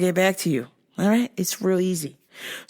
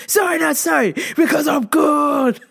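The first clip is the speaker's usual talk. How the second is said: fired up; 6 semitones higher, 3 dB louder than usual. Real, not acted. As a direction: crow it, stretching the last words. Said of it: "I'm all fired up".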